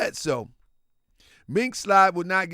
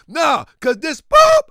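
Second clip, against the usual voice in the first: angry outburst; 7 semitones higher, 10 dB louder than usual. Retold or imitated. Friend cussing angrily.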